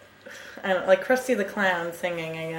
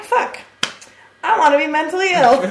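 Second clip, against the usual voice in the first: loud, high-pitched comic outburst; mock outburst; 8 semitones higher, 8 dB louder than usual. Telling co-host the film's effect on her, mock outburst. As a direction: blurt it out as a mock wail. Quotes own exasperated reaction to film.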